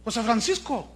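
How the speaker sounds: wife's shrill outraged question; indignant wife. Acted. Wife catches husband with lover.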